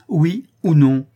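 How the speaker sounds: high, loud, drawn-out ultimatum; insistent repetition. A narrator's reading. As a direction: demand an answer, slow and emphatic. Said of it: "répéta-t-elle".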